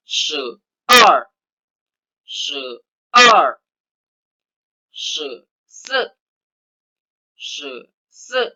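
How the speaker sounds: "Se ar" and "se se" called out tone by tone; Mandarin count. Shown as a demonstration. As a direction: lead the class in "se ar, se se". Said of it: "Bersama-sama".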